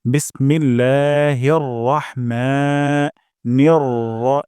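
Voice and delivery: বিসমিল্লাহির রহমানির drawn out slowly; slow recitation demo. Shown as a demonstration. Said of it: "এক আলিফ টান দিব". (ধীরে তেলাওয়াত)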